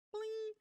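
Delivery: high sung chime like a phone alert; ping it out high like a game notification. Acted.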